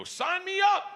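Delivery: high, loud burst of enthusiasm; gleeful high shout; eager outburst. Retold or imitated. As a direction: jump at the offer with a yell. Acted eager answer to gun offer.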